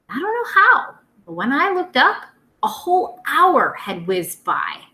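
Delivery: put-on lively storytime voice for young kids; reading a picture book aloud. Teacher reading to her second graders, lively storytime. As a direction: read it out to the class with bounce.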